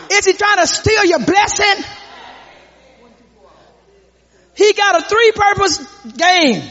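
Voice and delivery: rising, shouted call-and-response sermon; fired-up gospel preacher; preaching at full force. Acted. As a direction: whip up the church, voice climbing.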